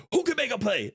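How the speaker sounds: high call to the bench; rally the team with a high shout; teammate hype call. Retold or imitated. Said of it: "a teammate going".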